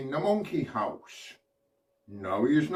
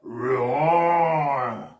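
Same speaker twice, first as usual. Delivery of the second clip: lion roar; 4 dB louder than usual. Shown as a demonstration. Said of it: "Can we all do an impression of a lion?".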